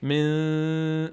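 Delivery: ordinary talk, nothing marked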